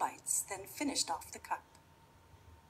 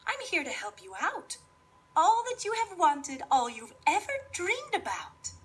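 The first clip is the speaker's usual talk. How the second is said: fairy godmother voice; 11 semitones higher, 8 dB louder than usual. Acted.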